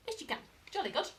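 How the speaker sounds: high sing-song praise; dog praise. Real, not acted. Praising the dog for spotting the treat, high sing-song.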